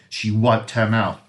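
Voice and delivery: usual speaking voice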